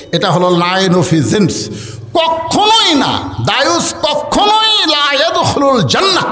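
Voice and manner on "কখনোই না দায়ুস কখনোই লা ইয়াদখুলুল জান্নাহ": high, drawn-out stress on কখনোই; emphatic warning. Real, not acted. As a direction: hit কখনোই high and stretch it out. Emphatic warning, high shouted never.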